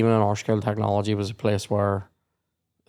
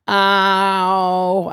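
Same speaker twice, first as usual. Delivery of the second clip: higher mock ow; a casual ow as the finger is cut; deadpan ow; 8 semitones higher, 4 dB louder than usual. Boy cutting his finger on the jigsaw, mock mild ow. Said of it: "just went".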